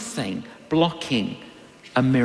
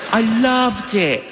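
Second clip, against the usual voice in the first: higher, louder "loved" with a beaming push; enthusiastic; 6 semitones higher, 4 dB louder than usual. Real, not acted. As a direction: gush about "loved" like a happy barista. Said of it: "I was a barista".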